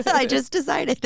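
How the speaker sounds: giggly, amused at herself; laughing. Real, not acted. Host jokes to guest about calling it a show, laughing high.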